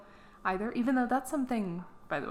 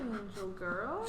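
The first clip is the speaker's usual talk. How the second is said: soft, slow pet talk; cooing; 6 dB quieter, slower than usual. Real, not acted. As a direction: coo it to the cat. Cooing to the cat.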